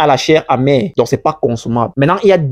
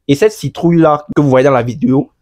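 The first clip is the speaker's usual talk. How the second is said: normal talking voice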